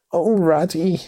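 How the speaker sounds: high, plaintive cry of despair; dismal cry. A narrator's reading. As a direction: wail it miserably. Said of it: "he cried dismally".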